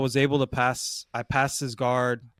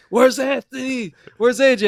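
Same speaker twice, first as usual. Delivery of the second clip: high-pitched, loud imitation of yelling; imitated yell; 11 semitones higher, 9 dB louder than usual. Teammate yelling at the man filming his match, shouted. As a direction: call out urgently, high and loud.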